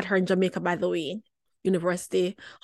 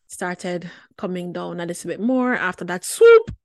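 normal talking voice

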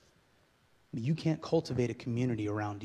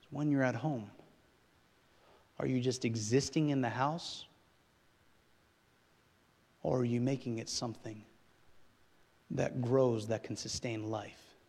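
usual speaking voice

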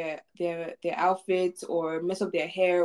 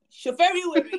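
excited call; 10 semitones higher, 3 dB louder than usual. Real, not acted. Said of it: "you wanna see crazy".

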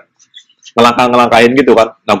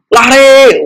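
urgent yell; 11 semitones higher, 4 dB louder than usual. Retold or imitated. Fleeing the departing train.

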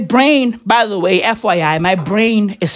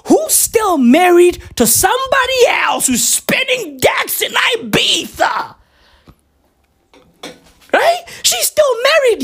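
outraged shout; 11 semitones higher than usual. Real, not acted. Outraged gossip about side piece.

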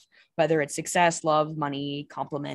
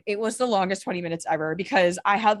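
normal talking voice

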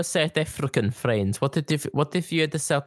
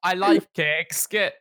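northern accent; slower than usual. Shown as a demonstration. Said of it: "With the northern accent included".

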